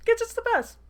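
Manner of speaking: very high, loud fangirl squeal; squealed cheer. Real, not acted. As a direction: squeal in delight for your hero. Reader repeats cheer, more excitedly.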